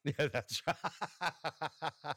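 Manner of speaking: slow words through a laugh. Real, not acted. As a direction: agree while still laughing. Agrees while laughing.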